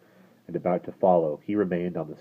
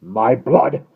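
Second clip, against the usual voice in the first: loud, higher outburst of annoyance; vexed coachman cursing; vexed exclamation; 6 semitones higher, 11 dB louder than usual. Acted. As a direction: blurt it out, irritated. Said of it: "ejaculated the vexed coachman".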